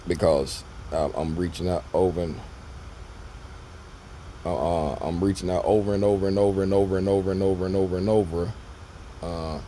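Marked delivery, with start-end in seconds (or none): 5.0-8.5 rapid exasperated list. Real, not acted. Stressing repeated outreach.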